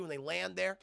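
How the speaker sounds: normal talking voice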